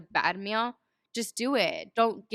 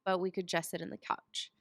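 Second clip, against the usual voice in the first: lazy inner voice; 2 semitones lower, 7 dB quieter than usual. Retold or imitated. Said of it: "a little voice in your head that's saying".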